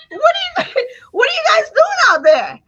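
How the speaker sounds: rising, loud disbelieving call; incredulous shout. Pretend. Speaker calling out to overwhelmed people, incredulous shout. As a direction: yell out in disbelief at them.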